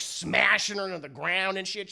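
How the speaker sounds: ordinary talk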